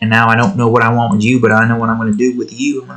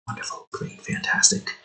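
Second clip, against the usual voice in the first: whispered deadpan sarcasm, no voice at all; a voiceless sarcastic aside; 14 dB quieter than usual. Real, not acted. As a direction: breathe it out, utterly unimpressed. Sarcastic whisper after a mistake.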